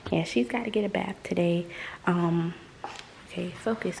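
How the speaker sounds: usual speaking voice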